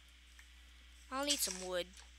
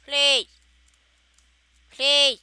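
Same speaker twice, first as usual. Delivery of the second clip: pleading call; 3 semitones higher, 13 dB louder than usual. Real, not acted. Begging to find a cow.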